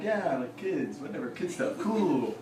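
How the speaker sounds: mock-approving, low-key camp counsellor; indulgent work voice. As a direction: humour the kids quietly, dragging out the last words. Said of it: "I talk like that a lot when I'm at work".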